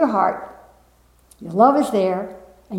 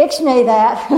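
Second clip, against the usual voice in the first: laughing; 3 semitones higher, 4 dB louder than usual. Real, not acted. Laughs while telling.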